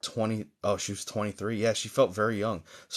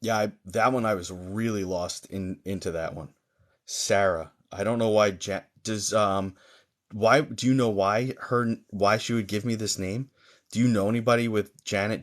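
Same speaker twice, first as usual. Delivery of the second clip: ordinary talk, nothing marked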